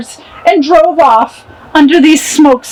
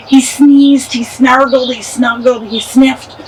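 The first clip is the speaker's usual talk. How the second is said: plain reading voice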